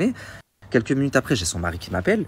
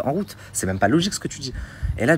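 ordinary talk

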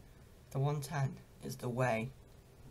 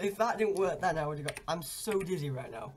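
ordinary talk

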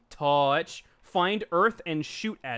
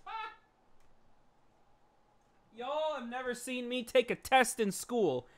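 usual speaking voice

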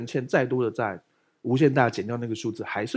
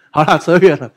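laughing; 9 dB louder than usual. Real, not acted.

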